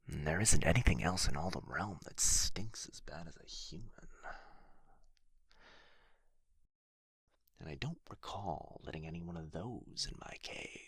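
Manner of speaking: hushed, suspicious whisper; a dragon whispering as it sniffs out an intruder. Acted. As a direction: breathe the words as you sniff the air. Dragon senses intruder in cave.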